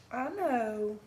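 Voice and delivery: ordinary talk, nothing marked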